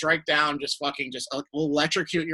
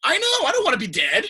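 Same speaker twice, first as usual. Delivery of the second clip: whiny; 14 semitones higher, 4 dB louder than usual. Pretend. Whining about costume role.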